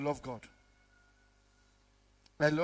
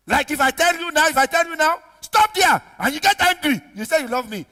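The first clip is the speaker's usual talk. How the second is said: loud, high rebuke; full-force preaching; 8 semitones higher, 10 dB louder than usual. Real, not acted. Preacher rebuking those who anger when corrected, full-force preaching. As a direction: confront the listeners loudly.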